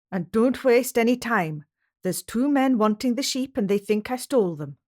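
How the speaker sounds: a Scottish husband waking his wife in danger; urgent Scottish shepherd. A narrator's reading. James voiced by narrator.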